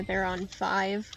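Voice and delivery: usual speaking voice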